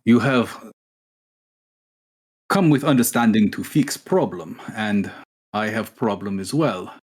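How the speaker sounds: a rough, hammed-up Russian accent, slow in places; cheesy Russian accent. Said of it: "It's like a cheesy Russian accent".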